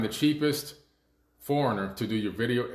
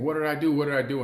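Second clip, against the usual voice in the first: higher, pleading mock yell; retold scream; 5 semitones higher, 3 dB louder, faster than usual. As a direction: echo a desperate cry, half-voiced. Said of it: "he's screaming".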